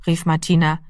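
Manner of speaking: ordinary delivery, nothing marked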